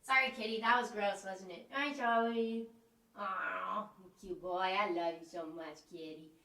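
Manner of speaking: soft cooing to the cat; talking to a pet. Real, not acted. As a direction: coo gently to your kitty. Talks softly to her cat.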